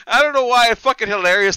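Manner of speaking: ordinary talk, nothing marked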